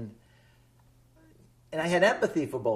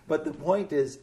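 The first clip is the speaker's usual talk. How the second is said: ordinary talk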